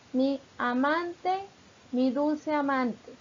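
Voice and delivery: drawn out, syllable by syllable; slow, deliberate recitation for counting syllables; very slow verse reading. Shown as a demonstration. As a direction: stretch every syllable so the joins are heard. Teacher reads verse slowly.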